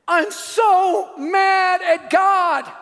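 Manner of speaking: imitating a high, very slow, anguished wail; tearful outburst. Grieving shopper to the preacher, acted tearful outburst. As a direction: cry it out in drawn-out, acted anguish. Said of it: "she burst in tears".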